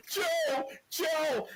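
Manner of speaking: high mock call after someone; imitating a calling Jey. Retelling Jey calling after Roman, high mock call. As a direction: call out high like a brother left behind. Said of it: "you hear Jay going".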